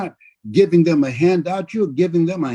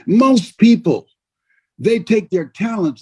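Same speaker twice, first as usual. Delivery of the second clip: usual speaking voice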